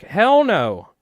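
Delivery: high, loud mock outrage; incredulous retort. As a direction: scoff at the idea of an eighty dollar hackle. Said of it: "And I'm like".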